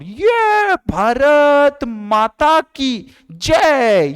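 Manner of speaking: stretched, booming patriotic cry; loud shout. Shown as a demonstration. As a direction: bellow the slogan like a rally chant. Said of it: "और जोर से चिल्लाना, loud".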